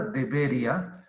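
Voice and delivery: DEBERÍA said de-BE-ria, stressed on BE; DEBERÍA with stress moved back to BE; diphthong instead of hiatus. Shown as a demonstration. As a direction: merge the ia of DEBERÍA into one syllable. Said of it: "habría una, un diptongo".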